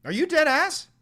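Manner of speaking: very high, loud squeal; squeal of disbelief. Real, not acted. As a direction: shriek the question in disbelief. Third time, squealing disbelief.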